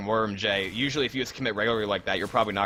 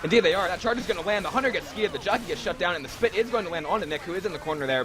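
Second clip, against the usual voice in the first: excited; 6 semitones higher than usual. Real, not acted. Live play-by-play of a hit.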